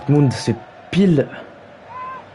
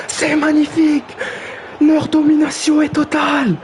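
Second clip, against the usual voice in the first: much higher, ecstatic commentator shout; goal celebration; 10 semitones higher than usual. Acted. Commentator hailing Portmund's second goal, ecstatic high call. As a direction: let the second goal lift you into pure joy.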